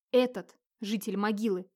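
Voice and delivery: usual speaking voice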